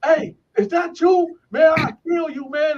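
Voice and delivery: high-pitched imitated shout; retold yelling down the tier. Angry inmates threatening a snorer down the tier, imitated yelling. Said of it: "people yelling down".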